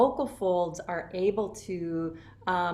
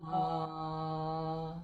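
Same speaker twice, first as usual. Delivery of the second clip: low, soft ah; a low easy ah; low sound; 4 semitones lower, 6 dB quieter than usual. Shown as a demonstration. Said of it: "just a low sound".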